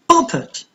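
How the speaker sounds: "property" with PRO high, then falling; PRO-per-ty, first syllable of "property" lifted; stress on first syllable. Shown as a demonstration. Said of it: "the stress is on the first syllable".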